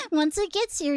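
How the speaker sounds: laughing at herself. Real, not acted. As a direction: giggle through the repeat.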